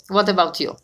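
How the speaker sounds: ordinary talk, nothing marked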